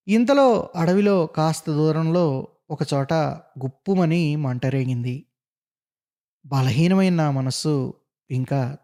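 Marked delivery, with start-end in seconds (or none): none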